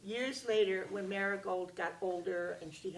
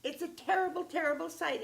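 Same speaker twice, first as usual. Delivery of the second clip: upset daughter; 9 semitones higher, 6 dB louder than usual. Acted. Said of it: "And she said".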